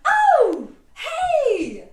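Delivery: high squealing cheer; over-the-top cheering squad; cheerleader voice. Pretend. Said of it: "your cheerleaders come out".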